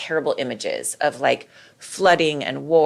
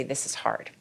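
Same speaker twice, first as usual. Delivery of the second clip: gentle; 5 semitones lower, 9 dB quieter than usual. Shown as a demonstration. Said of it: "but you say".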